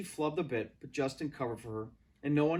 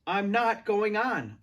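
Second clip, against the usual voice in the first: slow, loud and high, leaning on not; loud refusal; 7 semitones higher, 7 dB louder, slower than usual. A narrator's reading. Girl refusing the director, loud and tearful. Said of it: "I said loudly".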